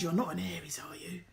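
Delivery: soft, toneless whisper of a suspicion. Retold or imitated. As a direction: whisper it as if sharing a secret. She suspects the shared birthday.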